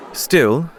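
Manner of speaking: plain reading voice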